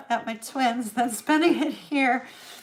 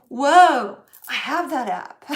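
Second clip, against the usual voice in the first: loud startled whoa that settles; sudden loud whoa of recognition; 2 semitones higher, 8 dB louder, slower than usual. Retold or imitated. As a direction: blurt the whoa out in surprise. Surprised discovering app.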